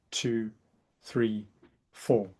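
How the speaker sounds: soothing breath coach counting; guided breathing count. Shown as a demonstration. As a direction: count them calmly through the breath. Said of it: "I'm gonna count you through".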